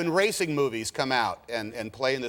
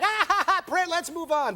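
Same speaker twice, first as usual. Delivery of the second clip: high, gleeful laugh and go-ahead; imitated burst of satisfaction; delighted director; 14 semitones higher than usual. Imitating Spielberg usually happy with a take, delighted. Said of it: "usually he's back".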